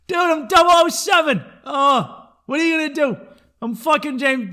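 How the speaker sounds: loud, high, hammed-up bragging; comic brazen shout; mock shouted boast. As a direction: crow it at full volume. Acting out Connery's brazen boast.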